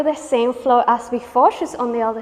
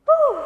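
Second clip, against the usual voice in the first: sharp high whoop of relief; 4 dB louder than usual. Real, not acted. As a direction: let out a quick high whoop. Relief after the set.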